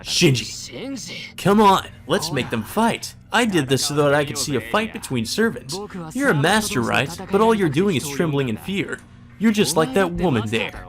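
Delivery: high, gloating sneer; taunting Shinji. Acted. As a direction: rub his fear in his face.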